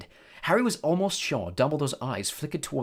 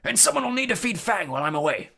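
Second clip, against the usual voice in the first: high, raised voice; loud; 10 semitones higher, 5 dB louder than usual. A narrator's reading. Hagrid leaves instructions about Fang, loudly. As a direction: call out a parting reminder. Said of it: "and said loudly".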